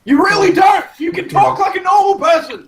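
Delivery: high, loud protest; shouting over the accent, fed up; exasperated shout. Real, not acted. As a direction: yell it, pleading in exasperation.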